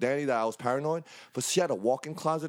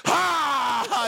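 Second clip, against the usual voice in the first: higher, louder burst; mock ambush yell; 11 semitones higher, 5 dB louder than usual. Teller reenacts leaping from the closet, loud and high. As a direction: pop out with it. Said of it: "jumped out of the closet like".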